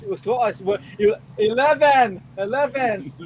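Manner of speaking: mock-exasperated Scot repeating himself; Scottish accent. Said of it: "say it was Scottish".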